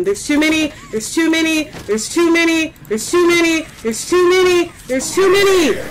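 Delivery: rising panicked repeating; terrified gibbering. Real, not acted. Overwhelmed by enemies.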